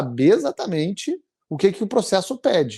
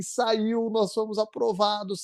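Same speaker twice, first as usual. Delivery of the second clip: softer, higher, a hint of tearful joy; wife's emotional news, retold; 5 semitones higher, 6 dB quieter than usual. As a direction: pass on the news gently, pitched up. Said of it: "E daí ela falou assim". (esposa dando a notícia, mais suave e emocionada)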